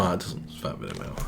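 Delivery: usual speaking voice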